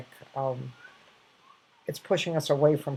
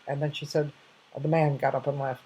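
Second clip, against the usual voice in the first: usual speaking voice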